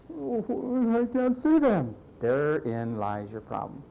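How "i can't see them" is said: mock servant voice. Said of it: "servant's voice".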